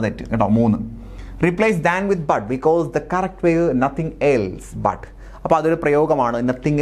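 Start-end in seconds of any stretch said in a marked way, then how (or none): 1.5-5.0 slow dictation read. Shown as a demonstration. Dictating answer key correction.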